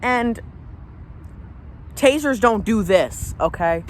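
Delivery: high, heated ranting; mad. Real, not acted. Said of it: "this makes me mad".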